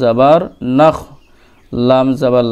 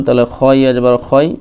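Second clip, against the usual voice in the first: ordinary talk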